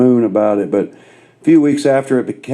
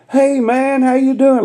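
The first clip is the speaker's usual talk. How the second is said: high, overly friendly mock greeting; hammed-up buddy hello; 14 semitones higher than usual. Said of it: "he looks at me and goes".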